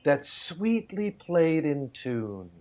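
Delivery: poem recital. Shown as a demonstration. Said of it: "I'm gonna say a line of poetry".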